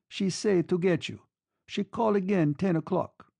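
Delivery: an Italian orderly relaying a message; Italian accent. A narrator's reading. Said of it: "The accent was Italian".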